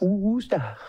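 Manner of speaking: "Worcester" as a high, drawn-out "Wooo-stah"; "Worcester" crooned as "WOOO-sta"; playful mispronunciation. Shown as a demonstration. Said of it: "Is that how you pronounce it?".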